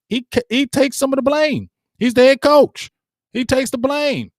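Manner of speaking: ordinary talk, nothing marked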